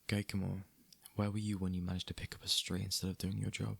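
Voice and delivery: ordinary talk, nothing marked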